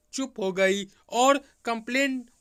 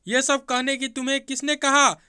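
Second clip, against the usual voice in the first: higher, louder demand; raised voice; 5 semitones higher, 4 dB louder than usual. A narrator's reading. Sister-in-law demands who told Amit, raised voice. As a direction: raise the voice in irritation. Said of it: "थोड़ी तेज आवाज़ में बोली".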